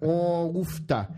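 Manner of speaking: put-on comic folksy Canadian accent. Betting a Canadian had heard of it, Canadian accent. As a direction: play up a mock Canadian accent. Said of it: "That's my Canadian accent".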